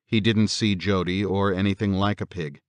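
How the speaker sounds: ordinary delivery, nothing marked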